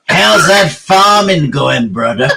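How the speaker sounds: slow, hammed-up American farmer drawl; American farmer accent.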